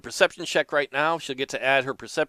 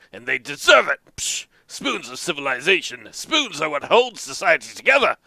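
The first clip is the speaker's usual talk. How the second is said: put-on cranky old man ranting about spoons; grumpy old farmer; 4 semitones higher, 6 dB louder than usual. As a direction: grumble like an indignant old farmer. Earl rants about spoons.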